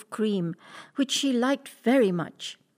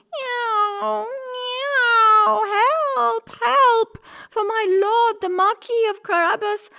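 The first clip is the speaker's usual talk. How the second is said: much higher, louder wailing cat; loud crying for help; 13 semitones higher, 5 dB louder, slower than usual. Acted. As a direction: wail for help like a frantic cat. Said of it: "she began to cry very loudly".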